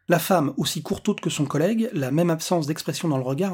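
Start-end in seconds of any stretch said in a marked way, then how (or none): none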